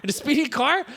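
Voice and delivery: high, baffled protest; mock incredulity. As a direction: question it as if the order were absurd. Baffled man protests, retold quarrel.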